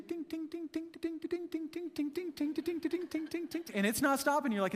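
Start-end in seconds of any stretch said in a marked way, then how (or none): 0.0-3.6 high soft ting ting of a fork on a plate; imitated fork tinging. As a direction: tap it out lightly like a bored kid drumming. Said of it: "in the distance you hear ting".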